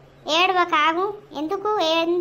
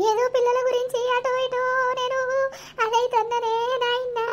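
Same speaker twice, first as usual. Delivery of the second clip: high, sing-song keening for the dead; tearful mourning chant, pitched high; wailing lament; 6 semitones higher than usual. Acted. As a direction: wail it out, drawing out the ends of phrases. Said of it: "ఊకో, ఏడవకు".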